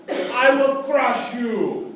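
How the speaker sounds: crush them like a movie strongman; Arnold Schwarzenegger impression. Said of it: "the old Arnold Schwarzenegger".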